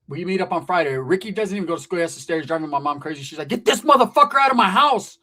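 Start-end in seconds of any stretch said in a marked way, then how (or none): 3.5-5.2 loud, raised-pitch acted outburst; angry mother. Mother driven crazy by the cousin, yelling. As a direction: throw him out as a fed-up mom.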